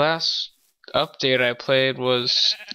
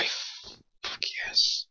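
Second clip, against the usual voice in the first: whispering; 9 dB quieter, slower than usual. Real, not acted. Excited find, whispering.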